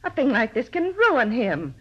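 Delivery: upset protest. Acted. Landlady defends Mitchell to the detective, pitch climbing in upset. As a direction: plead his case in rising alarm.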